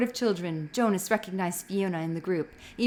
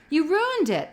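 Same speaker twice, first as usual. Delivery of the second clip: loud, high, irritated complaint; 9 semitones higher, 6 dB louder than usual. A narrator's reading. Asher complains to Jonas, loud and irritated. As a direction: blame him for spoiling the game. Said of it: "in an irritated voice".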